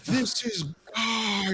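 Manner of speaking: slow, high mock proclamation; dark lord. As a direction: proclaim it like a cult priest.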